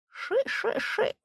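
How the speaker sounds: soft, drawn sh like a grass snake; snake hiss. A narrator's reading. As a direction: hiss it softly from the reeds. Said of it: "но шипит".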